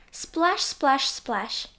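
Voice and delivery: ordinary delivery, nothing marked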